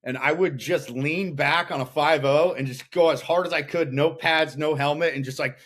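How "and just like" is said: ordinary talk, nothing marked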